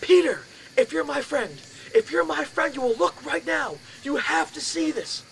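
high, slow, desperate plea; pleading yell. Retold or imitated. Begging his roommate Peter to wake up and look, pleading yell. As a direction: beg your friend to look. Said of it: "So I yell".

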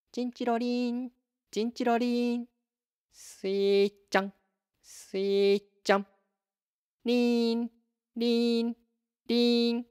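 高く一定の音程で伸ばす虫の音; 澄んだ虫の鳴きまね、一音ずつ伸ばして; 秋の虫の声. Acted. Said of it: "秋の虫の美しい声が聞こえています". (高い声で歌うような秋の虫の鳴き声)

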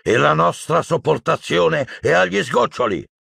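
high-pitched warning cry; shouting Magorian. A narrator's reading. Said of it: "gli gridò Magorian". (grido di avvertimento, acuto)

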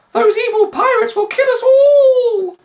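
high, loud, wailing woman; wailing Annie Beth. A narrator's reading. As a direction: shriek it in despair, very high. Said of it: "wailed Annie Beth".

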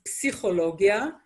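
psychologia with the opening P pronounced; Hebrew pronunciation, sounded P. Shown as a demonstration. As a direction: say psychologia the Hebrew way, p-see-kho-log-ya. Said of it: "we do pronounce the p".

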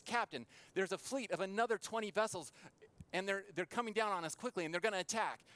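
high, loud, hurried sailor's alarm; urgent warning. Retold or imitated. Sailor warns captain that a fleet is attacking, urgent. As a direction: act out raising the alarm, voice lifted.

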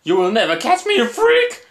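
high-pitched panicked shout; frightened defiant yell. Acted. Terrified victim shrieking at the killer, high and panicked. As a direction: scream it back as you flee.

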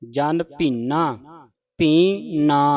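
ਭੀਨਾ drawn out syllable by syllable, with a nasal "na"; slow careful recitation. Shown as a demonstration. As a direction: stretch ਭੀ ਨਾ very slowly. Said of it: "ਨਾਸਕੀ ਸ਼ਬਦ ਹੈ". (ਹੌਲੀ ਹੌਲੀ ਅੱਖਰ ਅੱਖਰ ਕਰਕੇ)

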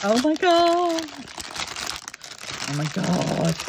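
high, loud disbelieving gasp; shocked exclamation. Real, not acted. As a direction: cry out in shock. Surprise at many paint bags.

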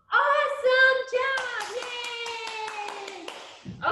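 high, slow, beaming praise; excited praise. Real, not acted. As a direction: cheer them on warmly. Teacher praising kids' ballet moves.

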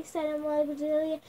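usual speaking voice

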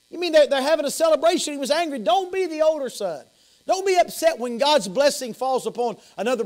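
high, urgent pulpit exhortation; fervent preaching. Real, not acted. Preacher warns church against being the older son, fervent exhortation. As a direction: exhort the congregation with rising force.

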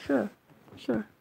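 "sure" as a short, unstressed "shuh"; reduced shire ending. Shown as a demonstration. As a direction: say "sure" as a clipped weak syllable, shuh. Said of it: "It's a sh, not a shire".

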